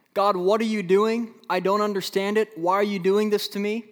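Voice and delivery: high, loud, exasperated questioning; loud pleading "why me"; bewildered complaint. Acted. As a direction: protest to God, confused and hurt. Voicing the prophet's complaint to God.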